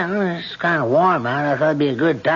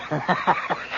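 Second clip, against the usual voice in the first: chuckle; 6 dB quieter than usual. Acted. Charlie laughs at own joke.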